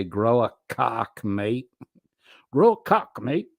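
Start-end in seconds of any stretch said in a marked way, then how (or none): 2.5-3.5 high, loud, shaky-attempt Aussie accent. Streamer acting out a chat joke, attempted Aussie accent. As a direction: have a go at an exaggerated Australian accent. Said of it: "don't have a good Aussie accent".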